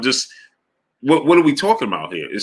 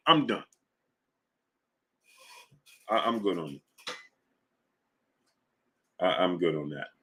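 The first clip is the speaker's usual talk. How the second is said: much lower and softer, giving up; resigned; 7 semitones lower, 11 dB quieter than usual. Real, not acted. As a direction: drop it, defeated. Gives up on argument.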